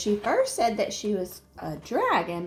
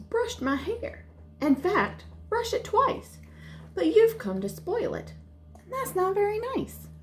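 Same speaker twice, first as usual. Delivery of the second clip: put-on higher, indignant little girl; indignant child; 11 semitones higher than usual. Jaya scolds the fairy for spoiling her hair, higher and indignant. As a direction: tell the fairy off like a cross child.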